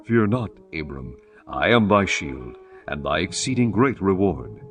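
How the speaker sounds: ordinary delivery, nothing marked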